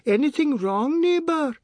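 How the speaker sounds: very high, loud neighbour's call; piercing sing-out to the neighbours; shrill cry. A narrator's reading. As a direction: call shrilly from next door. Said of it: "a woman cried shrilly".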